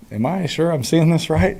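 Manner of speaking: high-pitched, retold double take; playfully incredulous thinking aloud; disbelieving self-question. Acted inner doubt at open receiver.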